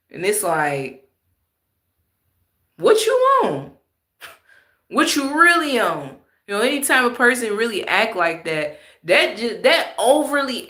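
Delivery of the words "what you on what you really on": incredulous. Real, not acted. Incredulous reaction to fake talk.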